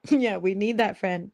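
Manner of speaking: normal talking voice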